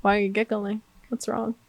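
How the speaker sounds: softly offended retort; understated indignation. Retold or imitated. Retelling a challenge to giggling boyfriend, quietly indignant. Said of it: "the fucking independent woman in me was like".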